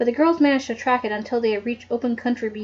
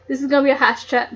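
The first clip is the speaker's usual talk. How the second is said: ordinary delivery, nothing marked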